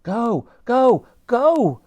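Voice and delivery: high, loud urging call; high shouted go go go. A narrator's reading. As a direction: hurry everyone on. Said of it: "called out Elsie".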